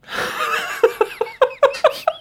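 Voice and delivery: shrieking high laugh; helpless high-pitched laughter; high cackle. Real, not acted. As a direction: burst into a squealing laugh. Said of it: "Oh my God".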